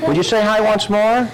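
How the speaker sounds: normal talking voice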